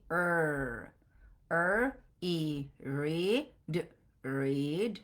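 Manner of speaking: রিড built slowly from আর and ই; blending read. Shown as a demonstration. Teacher blending রিড slowly for students. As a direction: sound out আর, ই, then রিড. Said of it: "So first সাউন্ডটা কি?".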